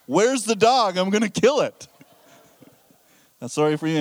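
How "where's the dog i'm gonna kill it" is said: loud, higher-pitched comic outrage; mad. Retold or imitated. Husband learning the dog peed on his floor, mad. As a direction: play up a furious homeowner's outburst. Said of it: "I'm getting, I'm mad".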